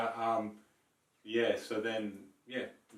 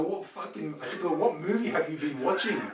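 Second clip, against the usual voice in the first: loud, higher, mock-outraged disbelief; exasperated retold "what on earth"; incredulous; 6 semitones higher, 10 dB louder than usual. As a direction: react as if the request is absurd. Acted incredulous reply to her request.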